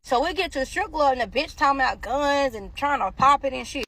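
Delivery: ordinary talk, nothing marked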